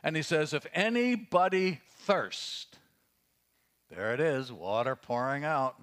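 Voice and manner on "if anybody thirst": raised, slow, carrying call to the crowd; projected cry. Retold or imitated. Jesus crying out to the crowd in the temple, a projected call. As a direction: lift it high and slow, calling out. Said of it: "Jesus stood and cried out".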